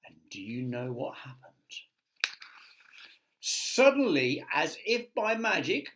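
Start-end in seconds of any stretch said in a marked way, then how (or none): 3.4-5.9 high, excited reveal; dramatic storyteller build. A narrator's reading. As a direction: announce the magic with wonder. Dramatic magic moment.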